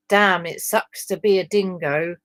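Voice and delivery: ordinary delivery, nothing marked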